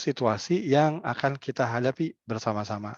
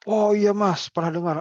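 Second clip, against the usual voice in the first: eager villager; 9 semitones higher, 5 dB louder than usual. Acted. Villager answering the facilitator.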